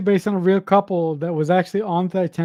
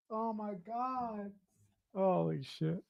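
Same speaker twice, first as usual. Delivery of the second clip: awed hush; 3 semitones higher, 17 dB quieter, slower than usual. Real, not acted. Stunned by the scene.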